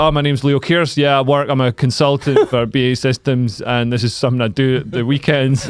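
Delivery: deflated out-of-character introduction. Retold or imitated. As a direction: drop the act and admit the day job. Drops the act, introduces himself.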